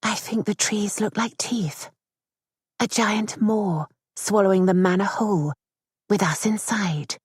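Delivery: soft, sinking to a whisper at the end. Acted. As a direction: confide it quietly, almost afraid to be heard. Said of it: "Louisa's voice was so soft".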